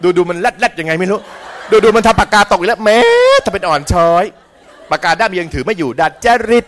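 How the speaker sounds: loud, high mock bitching; jealous scolding. Envious person running down someone they dislike, hammed-up scolding. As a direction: pick at her, high and loud, for comedy. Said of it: "นั่นน่ะด่าเข้าไป".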